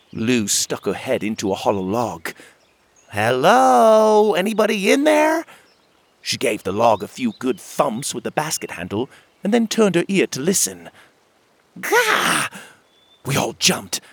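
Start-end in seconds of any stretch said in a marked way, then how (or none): 3.1-5.5 loud, high call into a hollow log. A narrator's reading. Lou calls into a log. 11.8-12.5 sharp, very high "Gah" of shock; startled yelp. A narrator's reading. Lou startled by a bug.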